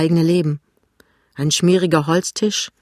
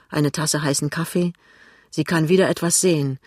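usual speaking voice

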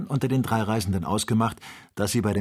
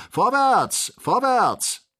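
very high, loud urging cry; merry shout; 14 semitones higher, 6 dB louder, much slower than usual. A narrator's reading. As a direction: yell it out to drive the animal on. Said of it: "schrie der fröhliche Joe". (fröhlich und schrill hoch angefeuert)

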